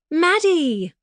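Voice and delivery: high, loud call of her name; joyful shout of "Maddie!"; shouted greeting. A narrator's reading. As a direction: call out to a friend across the road. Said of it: "my friend shouted".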